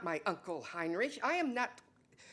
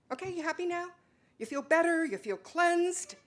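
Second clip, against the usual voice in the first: sarcastic; 9 semitones higher, 3 dB louder than usual. Acted. Bitter jab after confession.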